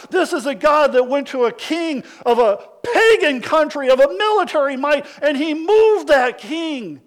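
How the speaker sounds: loud, high, rising sermon climax; a thundering preacher's build; preaching at full force. Acted. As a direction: preach it out to the back row.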